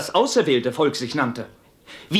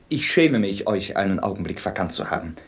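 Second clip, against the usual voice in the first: ordinary delivery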